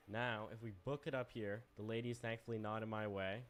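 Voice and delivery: normal talking voice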